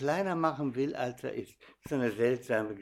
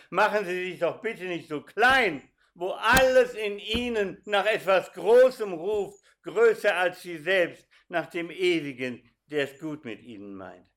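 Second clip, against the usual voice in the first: rising, louder, impassioned appeal; fervent preaching; 5 semitones higher, 5 dB louder than usual. Acted. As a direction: plead with the atheist, building up. (leidenschaftlich predigend)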